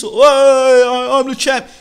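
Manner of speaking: high, loud mock boast; mock champ bragging. Imitating a fighter playing up to his crowd, high mock boasting. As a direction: crow like a showboating champ.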